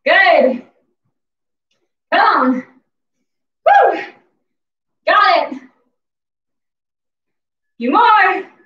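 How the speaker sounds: loud encouragement. Real, not acted. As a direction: drive them through the burn. Coach urging through hard set.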